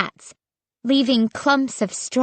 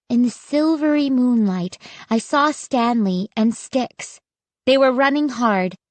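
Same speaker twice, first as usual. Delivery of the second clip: usual speaking voice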